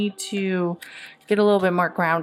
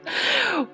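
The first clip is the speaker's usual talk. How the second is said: high burst of laughter. Real, not acted. Laughing at the tarot reading.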